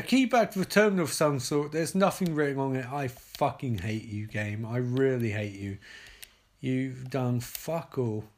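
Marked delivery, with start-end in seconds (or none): 2.9-8.2 lower, softer sulky grumble; grumbling at game. Real, not acted. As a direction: sulk at the game.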